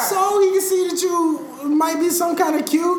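high, loud disbelieving yell; incredulous shout. Real, not acted. Incredulous teasing of cohost.

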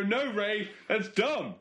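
high-pitched objection to a teammate; acted protest. The noob telling Ray off, high acted protest. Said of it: "jump up and down and go".

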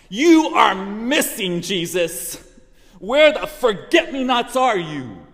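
loud, high, accusing yell; yelling at God. Retold or imitated. Wife yelling at God in the pharmacy, angry yell. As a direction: shout up at heaven in frustration. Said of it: "still fuming and yelling inside at God".

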